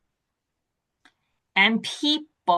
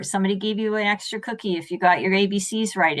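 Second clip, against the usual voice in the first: usual speaking voice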